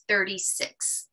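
"thirty-six" said slowly and softly, no dollars; slow, soft price. Shown as a demonstration. Drop the word dollars and say "thirty-six" slow. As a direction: coach shows a customer the price gently. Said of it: "You would say".

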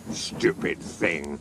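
low, slow, quiet cross muttering; cross grumble. A narrator's reading. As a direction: grumble it crossly under your breath. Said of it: "said Gordon crossly".

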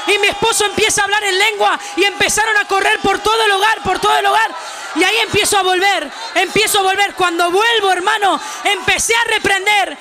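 impassioned Pentecostal preacher; fiery, sustained revival shouting; full-force preaching. Real, not acted. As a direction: preach it out loud to rouse the congregation.

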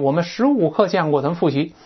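normal talking voice

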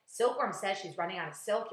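ordinary delivery, nothing marked

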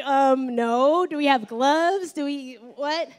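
acted-out hesitant, baffled refusal; reluctant volunteers. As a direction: balk like an unwilling congregation, drawing out the words. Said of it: "You guys would be like".

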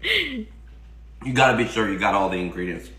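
talking between bites; chewing while talking. Real, not acted. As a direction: mouth partly full of food. Seen 0: bite taken just before; jaw and mouth busy during first words.

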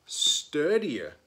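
ordinary delivery

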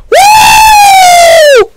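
very high, loud, long woo; shrieking whoop. Real, not acted. Viewer cheering the fight, piercing whoop. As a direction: whoop at the top of your range.

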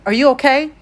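loud, high worried question; urgent fear for his friend; alarmed. A narrator's reading. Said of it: "I said, alarmed".

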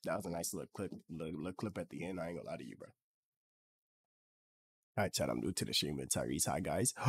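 ordinary talk

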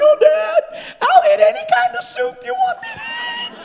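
put-on very high, loud wailing child voice; hammed-up squeaky begging; imitated pleading daughter. Daughter begging Dad to escape a spanking, exaggerated high-pitched wail. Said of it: "And she's like".

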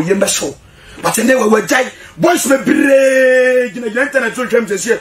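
ordinary talk, nothing marked

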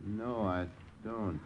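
a tense, barely voiced reply; slow hushed denial at the window. Strangers arriving late at night.

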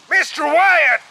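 high, loud call by name; shout. A narrator's reading. Captain calling to passenger on the sinking ship, shouting. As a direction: call out urgently across the water. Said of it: "shouted the captain".

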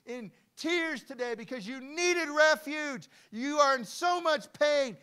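loud, high, impassioned proclamation; full-force preaching. Real, not acted. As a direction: cry it out over the congregation.

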